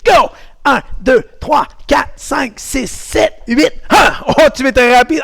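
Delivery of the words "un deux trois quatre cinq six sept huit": brisk, higher-pitched counting aloud; faster count. Shown as a demonstration. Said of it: "un peu plus vite". (compte plus vite, voix haute et énergique)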